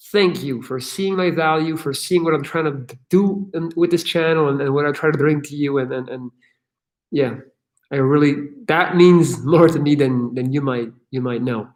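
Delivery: ordinary delivery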